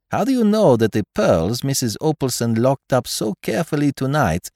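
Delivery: put-on reprove, then press the point in a French accent; French-accented detective. Poirot's dialogue in narration.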